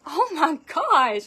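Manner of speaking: slow, loud shocked oh my gosh; shocked gasp. Retold or imitated. Retelling her shock at the comments, loud and drawn out. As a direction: react to the comments in disbelief.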